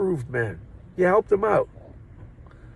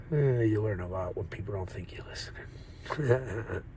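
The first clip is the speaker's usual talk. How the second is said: whisper; 4 semitones lower, 6 dB quieter than usual. Real, not acted. Confiding aside after eavesdropping story.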